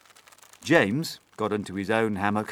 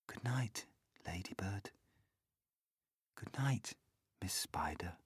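sleepy whispered good nights; 11 dB quieter than usual. A narrator's reading. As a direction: whisper each name as sleep comes. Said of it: "he whispered".

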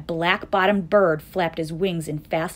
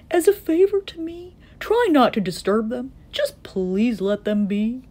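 high entreaty slowing at the end; pleading elephant; 6 semitones higher, 4 dB louder than usual. A narrator's reading. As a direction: beg earnestly, stretching the final plea.

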